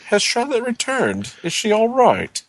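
put-on high, frail old gentleman; timid little old man asking; small old man voice. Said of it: "he goes".

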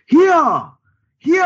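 high, very loud battle yell; full-voiced, high-pitched holler; character's shout. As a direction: shout it out to turn heads. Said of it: "I will turn around and shout".

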